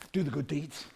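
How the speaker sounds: ordinary delivery, nothing marked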